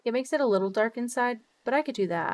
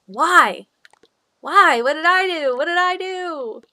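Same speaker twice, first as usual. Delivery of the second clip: hammed-up wail, higher and louder; mock despair; 8 semitones higher, 9 dB louder, slower than usual. Complaining to the weather god about warm Christmas, hammed-up wail. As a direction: plead to the sky in mock anguish.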